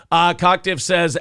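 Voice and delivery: ordinary talk, nothing marked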